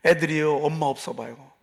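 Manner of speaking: ordinary talk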